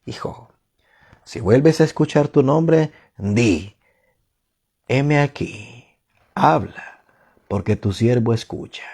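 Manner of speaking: soft, low, measured instruction; priest counselling Samuel. Acted. Priest tells the boy how to answer God, hushed. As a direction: quietly guide the boy, phrase by phrase. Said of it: "el sacerdote entendió que era Dios".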